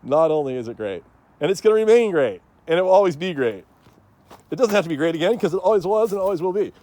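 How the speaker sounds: mock campaign-speech delivery, lightly voiced; quoting the former president. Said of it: "to quote, uh, former president".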